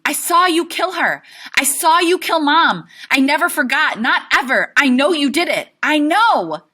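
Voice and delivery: high, loud screamed accusation; accusing scream. Acted. Boy screaming at his father that he saw the killing. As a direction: scream it, every line a blow. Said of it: "and I'm going".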